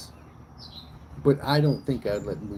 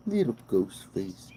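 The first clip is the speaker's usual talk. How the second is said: normal talking voice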